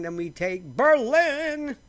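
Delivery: high, loud, slow belted line; hammed-up Broadway belting of a song lyric. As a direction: belt it like a Broadway diva. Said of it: "my [name] tribute".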